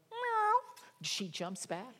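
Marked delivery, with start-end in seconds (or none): 0.1-0.8 high mock meow; high playful cat meow. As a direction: squeak it like a cat. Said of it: "the cat goes".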